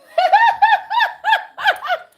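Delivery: loud high real laugh; burst of laughter. Real, not acted. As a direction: crack up loudly. Laughs at viewer comment.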